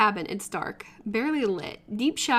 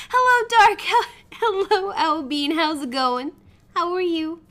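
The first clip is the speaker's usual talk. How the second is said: much higher, louder nervous survivor voice; nervous high greeting; 6 semitones higher, 7 dB louder than usual. Acted. Survivor hiding in the cauldron, nervously greeting Huntress. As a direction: squeak out the greeting, flustered and caught.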